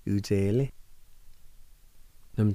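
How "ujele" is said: "Ujele" joined smoothly as one word; whole-word pronunciation. Shown as a demonstration. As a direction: say "Ujele" without breaks, rising at the end. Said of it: "is pronounced like this".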